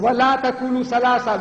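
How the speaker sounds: high, emphatic declamation; forceful commanding recitation. Shown as a demonstration. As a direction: declaim it as a firm prohibition. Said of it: "Look, he said".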